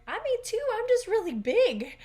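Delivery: put-on high, loud child voice, eager; imitating a little girl. Girl reassuring nervous younger classmate, high loud child voice. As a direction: squeak it out like an eager eight-year-old.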